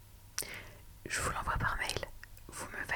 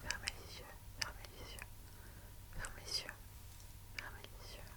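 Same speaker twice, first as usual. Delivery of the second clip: fading whisper; 5 dB quieter than usual. Acted. Répète en s'éloignant, de plus en plus bas. (chuchotement qui s'éteint)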